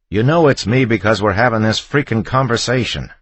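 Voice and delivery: loud, high frustrated retort; exasperated yell. A narrator's reading. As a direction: shout back out of patience. Exasperated husband shouting back.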